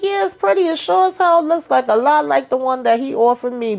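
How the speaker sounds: high, needling imitation; high passive-aggressive put-on; mock catty remark. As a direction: voice her sly dig up high. Quoted pointed remark about ring.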